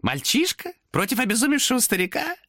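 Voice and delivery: high sly demon voice; demon objecting. Acted. Demon contradicts Thomas. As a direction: contradict him slyly.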